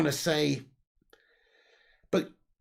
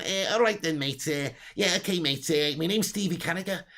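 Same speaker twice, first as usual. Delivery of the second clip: put-on Scouse patter; Scouse accent. Streamer doing Stevie Carragher for his viewers, Scouse accent. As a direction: play a chatty Scouser cadging favours. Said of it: "More Scouse accent, says Fergie".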